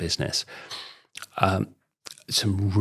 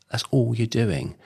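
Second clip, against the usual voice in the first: ordinary talk, nothing marked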